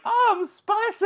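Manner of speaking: squeaky high mock voice, slow; mocking high squeak. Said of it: "Mike was like".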